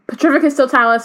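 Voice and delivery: high, loud spell cast; yelled spell. A narrator's reading. Harry casting at the Inferi, yelling. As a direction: fire the spell off with force. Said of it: "he yelled".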